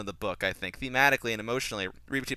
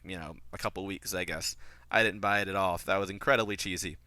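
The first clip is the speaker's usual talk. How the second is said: usual speaking voice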